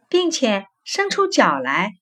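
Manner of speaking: usual speaking voice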